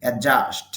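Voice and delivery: অ্যাডজাস্ট said as "অ্যাজাস্ট", the d left out; silent d pronunciation. Shown as a demonstration. Teacher showing students the silent d, a crisp clear demo. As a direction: say অ্যাডজাস্ট with no d sound, straight into the j. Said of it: "তাহলে উচ্চারণটা হবে".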